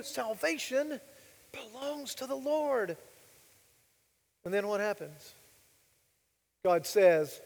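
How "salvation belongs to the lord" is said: soft, raised, drawn-out declaration; reverent. Real, not acted. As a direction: stretch it out slowly and softly, pitched up. Leading congregation in key sentence.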